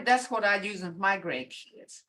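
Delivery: ordinary talk, nothing marked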